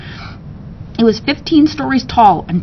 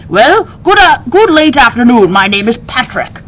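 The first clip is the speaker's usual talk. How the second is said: high, bright bird character with a stammer; chirpy parrot voice; 3 semitones higher, 4 dB louder than usual. Acted. Parrot greeting the strange new owner, high and eager. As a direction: play the parrot Patrick, eager and polite.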